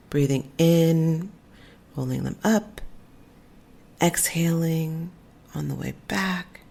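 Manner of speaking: slow, spaced-out breathwork instructions; guided meditation. Real, not acted. Teacher leads listeners through shoulder rolls, guided meditation. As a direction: guide each movement slowly, leaving room to breathe.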